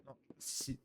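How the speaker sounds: scared whisper. Real, not acted. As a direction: whisper it nervously. Whispered in fear.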